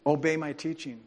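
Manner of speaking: ordinary talk, nothing marked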